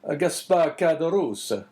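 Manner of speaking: put-on slow Italian-accented priest. A narrator's reading. Priest rejoining to the host, Italian accent. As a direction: repeat the name slowly with an Italian accent. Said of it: "speaking with a strong Italian accent".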